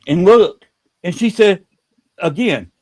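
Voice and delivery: plain reading voice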